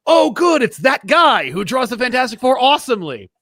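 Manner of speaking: put-on high, loud childlike delight; kid excitement. Remembering spotting a John Byrne comic as a kid, loud glee. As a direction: shout it like a thrilled kid at the comic rack. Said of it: "I'm like".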